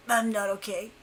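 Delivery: quiet, low, held-in voice; holding in nausea. Retold or imitated. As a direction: imitate speaking while trying not to throw up. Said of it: "I hold it in".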